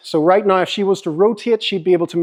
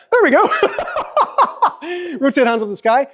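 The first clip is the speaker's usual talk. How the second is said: laughing; 11 semitones higher, faster than usual. Real, not acted. Laughing at the odd pose.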